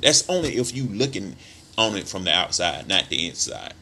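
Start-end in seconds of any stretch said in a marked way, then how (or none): none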